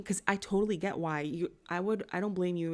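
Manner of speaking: usual speaking voice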